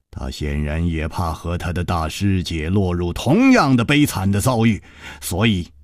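usual speaking voice

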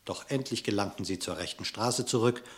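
usual speaking voice

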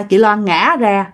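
usual speaking voice

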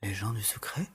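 stunned whisper; hushed whisper. Acted. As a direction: whisper it in dread. Parent reacting in hushed shock.

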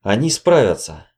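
plain reading voice

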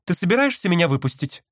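high bird-like voice; sister voice. A narrator's reading. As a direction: pitch up for the sister.

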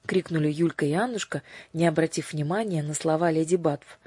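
ordinary delivery

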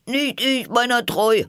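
sehr hoch und entrüstet abwehren; hohe Tierstimme. Acted. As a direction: quiekend die Schuld von sich weisen. Tier wehrt ab. (hohe, empörte Tierstimme)

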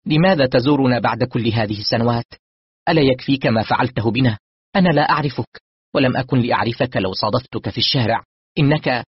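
loud, high-pitched angry confrontation; sharp. A narrator's reading. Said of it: "ابنتي الصغرى تقول بحدة". (حدة وغضب بصوت مرتفع)

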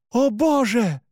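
high-pitched cry of horror; horrified cry. A narrator's reading. Narrator horrified by Alexander's fangs, crying out high. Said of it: "закричал я".

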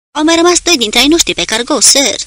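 high, loud shout across the deck. Acted. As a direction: yell it over the gunfire. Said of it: "Kane strigă la cei de pe pasarelă". (strigăt puternic, pe ton înalt)

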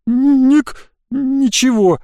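high, broken, tearful stammer; hiccuping through tears. A narrator's reading. Crying boy answering his friend, hiccuping sobs. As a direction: catch on the word as if sobbing. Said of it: "Он начал икать сквозь плач".

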